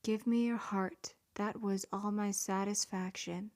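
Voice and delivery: normal talking voice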